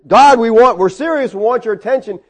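high, urgent outcry that starts loud; acted yelling. Desperate prophets calling on their god, acted yelling. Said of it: "started yelling and screaming".

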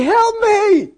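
high, loud mock cry for help; acted plea at the top of the register. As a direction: call out desperately, imitating the woman. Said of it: "she cries out more".

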